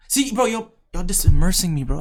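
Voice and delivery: high and loud overwhelmed exclaiming; hyped, overwhelmed listener; excited outburst. Real, not acted. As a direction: burst out, fully caught up. Excited reaction to story.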